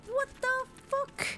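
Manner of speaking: high, slow stunned squeak; shocked squeak. Real, not acted. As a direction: caught completely off guard. Shocked reaction to the tale.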